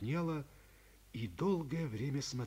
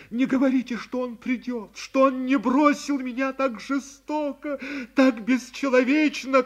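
louder, higher tearful protest; tearful outburst; 10 semitones higher, 14 dB louder than usual. A narrator's reading. Girl to her friend about the man who left her, tearful. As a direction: cry out against being abandoned. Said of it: "плача".

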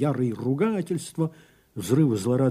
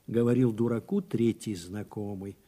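ordinary delivery, nothing marked

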